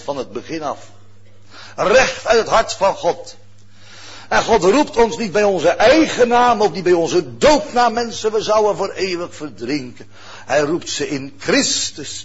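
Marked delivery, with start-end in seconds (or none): 1.8-10.1 louder preaching, raised. Acted. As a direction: proclaim it with force. Preaching at full force. (verheven preken)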